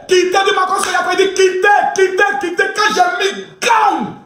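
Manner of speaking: high-pitched mock crier; high, insistent chanting shouts; excited mimicry. Acting out frantic street crier.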